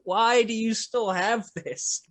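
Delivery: high and slow, half laughing; amused disbelief. Retold or imitated. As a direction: ask it like the thing is absurd. Repeating his incredulous question, laughing.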